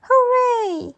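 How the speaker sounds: high, loud cheer; cheering hooray. Acted. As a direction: cheer it out like a happy child. Children cheering at story end.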